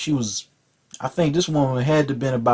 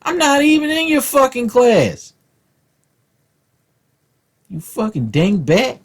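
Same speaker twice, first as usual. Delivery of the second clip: higher, heated mock comeback; mock outraged retort; 7 semitones higher than usual. Comic voicing his teenage self's comeback to the teacher, higher and heated. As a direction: fire back at the teacher, outraged. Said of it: "I'm like".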